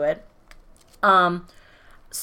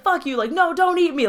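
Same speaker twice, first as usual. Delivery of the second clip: protesting gingerbread man; 9 semitones higher, 3 dB louder than usual. Retold or imitated. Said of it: "he's like".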